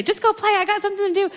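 high, fast mock dismissive mom; a busy mom waving off her child, hammed-up impatience; brushing kid off. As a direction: shoo the kid away in a put-on harried voice. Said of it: "you wanna say".

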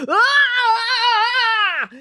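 long, high Tarzan-style yell; wavering jungle-call bellow; drawn-out howl of pain. A narrator's reading. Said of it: "Bruce was bellowing".

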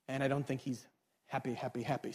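very low, soft deadpan; hushed understatement. Acted. Messengers warn Jacob that Esau is coming, hushed understatement. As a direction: let it sink to a small, low murmur.

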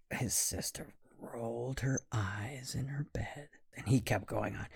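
narrating in a hushed whisper. A narrator's reading. Storyteller whispering about the sleepy sister. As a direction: breathe it so no one wakes.

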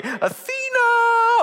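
very high drawn-out call, slow; exalting cry. Acted. Workers on Monday call out, slow very high praise. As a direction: stretch the name out in praise.

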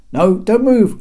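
calling out. Acted. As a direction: shout a warning up. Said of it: "called out Genikos".

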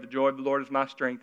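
a slave reciting a chore, mildly bored; bored duty reading. Shown as a demonstration. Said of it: "See the difference?".

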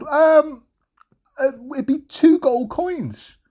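high, hesitant, slow quote; confused seller. Acted. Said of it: "was a bit confused".

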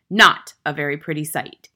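loud, disdainful headmistress; forceful contempt; utter disdain. A narrator's reading. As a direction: sneer down at the children, loudly. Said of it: "one of utter disdain".

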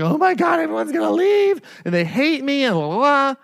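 hammed-up teary freakout, high and strained; retold tearful panic. Coach retelling his backstage panic, mock tearful wail. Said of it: "I was, like, in tears".

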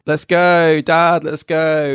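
high, drawn-out pleading; impatient daughter. Acted. Bored Helene nagging her dad to leave, impatient. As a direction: whine at Dad to leave, slowly. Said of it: "Helene's like".

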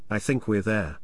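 ordinary delivery

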